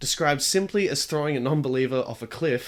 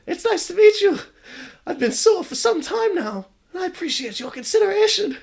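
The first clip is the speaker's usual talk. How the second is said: put-on very high, louder friendly girl voice; high sweet ghost girl voice; high smiling ghost girl; 7 dB louder than usual. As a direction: greet him kindly as the ghost girl. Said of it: "She smiles".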